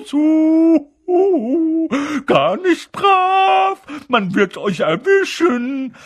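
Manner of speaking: very high, loud, drawn-out poltergeist singsong; mock them gleefully, stretching every word; cackling taunt. A narrator's reading. Peeves teasing the first-years caught at night, cackling taunt. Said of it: "Peeves lachte gackernd".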